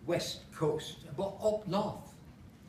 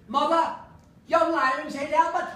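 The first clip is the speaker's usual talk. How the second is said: louder and higher Lancashire dad calling out in alarm; 8 semitones higher, 7 dB louder than usual. Acted. Pa calling to his wife about Albert, loud Lancashire cry. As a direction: call it across to Mother, Lancashire accent. Pa cries out to Mother.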